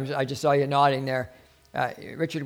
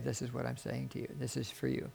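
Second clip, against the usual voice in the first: soft, hushed voice of the Lord; quietly imitating the Lord speaking; still small voice; 13 dB quieter than usual. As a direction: say it very softly, as a gentle inner prompting. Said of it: "that still small voice of the Spirit".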